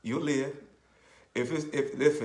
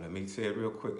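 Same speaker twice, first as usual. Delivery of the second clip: ordinary delivery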